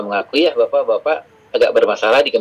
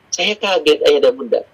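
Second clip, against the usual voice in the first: usual speaking voice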